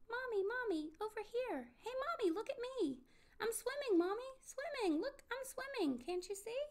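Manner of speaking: high, excited little voice; little child's voice. A narrator's reading. Pip-Pip shows Mommy he is swimming, excited little voice. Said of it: "came a little voice she knew".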